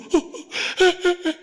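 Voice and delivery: high, jerky mock sobs; imitated sobbing. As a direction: act out bursting into sobs. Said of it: "울었더니 자기도". (꺽꺽 우는 흉내)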